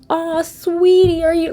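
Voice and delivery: high, slow, syrupy cooing at a poor dear; imitating someone patronizingly soothing; mock sympathy voice. Imitating sympathizers who patronize her, high slow coo. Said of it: "people are like".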